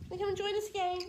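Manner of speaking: high sing-song call to a dog; high pet voice. Real, not acted. Calling the dog Bee.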